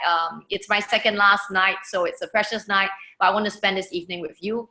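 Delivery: ordinary delivery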